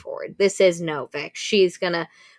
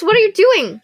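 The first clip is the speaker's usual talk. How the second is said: very high, loud yell; yelling at him; 12 semitones higher, 10 dB louder than usual. Retold or imitated. Elle yelling at Orion to stop, very high and loud. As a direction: scream the question at him. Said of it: "she ends up yelling at him".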